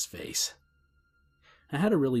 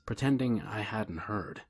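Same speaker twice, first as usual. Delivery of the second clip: plain reading voice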